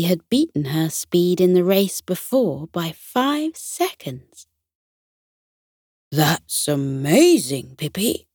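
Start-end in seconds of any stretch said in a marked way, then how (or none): 6.1-8.3 lightly voiced horses praising Pippi; cheering horses. A narrator's reading. Horses cheer on Pippi, mildly excited. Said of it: "the horses cheered".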